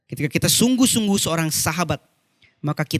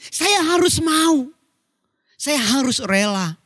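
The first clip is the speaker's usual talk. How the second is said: raised, fervent preaching voice; 10 semitones higher than usual. Real, not acted. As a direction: press each phrase home, stretching key words. Preaches with raised, drawn-out delivery. (khotbah berapi-api dengan nada naik)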